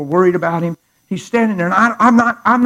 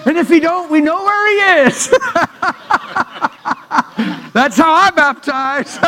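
high, loud, laughing through it; giggling punchline about baptism; laughing joke; 10 semitones higher, 5 dB louder than usual. Real, not acted. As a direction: crack up at your own joke.

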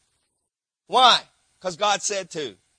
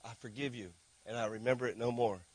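much softer and lower, tender assurance; gentle Jesus voice; 9 semitones lower, 12 dB quieter than usual. Acted. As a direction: forgive quietly, like a hand on the shoulder. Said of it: "you say".

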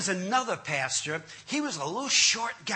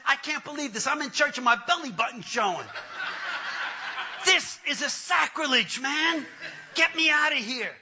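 high, scandalized comic protest; mock indignation; 6 semitones higher than usual. Man exposed in church during prayer, indignant high protest. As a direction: complain in hammed-up outrage.

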